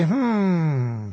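drawn-out thinking hum; a long hum to buy time; stalling hmm. Retold or imitated. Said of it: "to stall for time".

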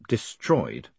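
low, flat and dry. A narrator's reading. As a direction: say it dead flat, unimpressed. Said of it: "said Moist flatly".

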